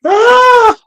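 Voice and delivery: high, loud "ah" of amusement; cracked-up exclamation; laughing cry. Real, not acted. Reacting to the film clip.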